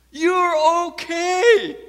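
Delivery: loud, high, very drawn-out proclamation; shouted reassurance. Acted. Preacher assuring the congregation, full-force shouted comfort. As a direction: shout it slowly to the whole church.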